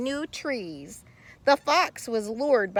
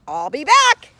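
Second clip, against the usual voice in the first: louder, higher promise; determined promise; 6 semitones higher, 10 dB louder than usual. Acted. Carl promising the beetle, loud. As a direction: say it with resolve.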